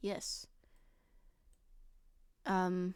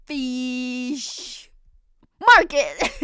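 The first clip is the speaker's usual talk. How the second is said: much higher, loud shout of market; mock shout; 5 semitones higher, 7 dB louder, much slower than usual. Streamer shouts the joke back at the streamer. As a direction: blurt it out loud.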